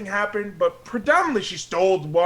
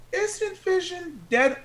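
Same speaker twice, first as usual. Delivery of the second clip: mock incredulity; 12 semitones higher, 4 dB quieter, much slower than usual. Incredulous question.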